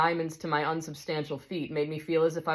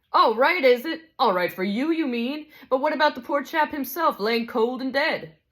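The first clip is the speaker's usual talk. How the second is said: higher, aggrieved working-man's complaint; indignant big ghost; 8 semitones higher, 4 dB louder than usual. Acted. Big ghost accuses the bright spirit over poor Jack, indignant. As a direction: press the grievance like a wronged bloke.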